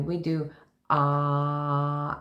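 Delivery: slow, open "ah" like at the doctor. Shown as a demonstration. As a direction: stretch out a wide "ah". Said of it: "what do we do?".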